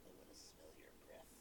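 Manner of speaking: breathy creep whisper; creepy whisper. Retold or imitated. Imitating creepy movie character.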